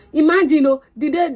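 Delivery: exclaiming. Real, not acted.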